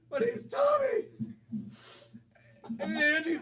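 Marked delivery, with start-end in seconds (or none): 0.1-1.1 much higher and louder; high-pitched proud announcement; excited wrangler introduction. Acted. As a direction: blurt it out in a high, loud voice. Acted wrangler repeats his name.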